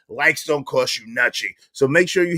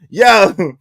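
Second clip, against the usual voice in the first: bursts out laughing; 8 semitones higher, 7 dB louder than usual. Real, not acted. Host cracking up after denying he said it, laughing. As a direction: laugh it out loud.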